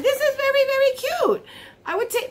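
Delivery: high-pitched gushing over something cute; delighted gushing. Real, not acted. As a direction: gush it up high. Delighted by the little packaging.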